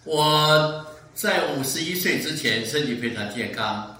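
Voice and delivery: ordinary delivery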